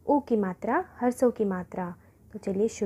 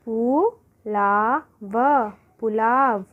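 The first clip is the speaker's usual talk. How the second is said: Pulao read slowly syllable by syllable; 3 dB louder, much slower than usual. Shown as a demonstration. Teacher to pupils, slowly spelling out pulao. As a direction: sound out पु ला व slowly then join. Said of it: "कुदाल".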